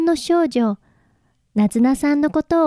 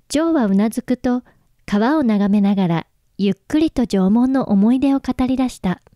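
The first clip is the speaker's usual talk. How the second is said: ordinary talk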